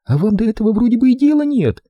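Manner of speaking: put-on high, reproachful young woman; girl's voice. A narrator's reading. Frightened girl reproaches Holman, high and hurt. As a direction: reproach him for not caring.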